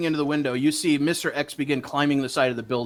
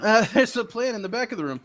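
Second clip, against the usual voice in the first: higher, laughing redo; amused mock repeat; 8 semitones higher than usual. Shown as a demonstration. Game master replays own line to players, amused and exaggerated.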